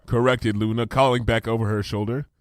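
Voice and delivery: ordinary delivery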